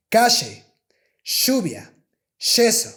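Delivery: Argentine calle, lluvia, yeso with a sh sound, like cashe, shubia, sheso. Shown as a demonstration. Teacher demonstrating the Argentine sh for Y. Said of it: "in Spanish from Argentina ... more like sh".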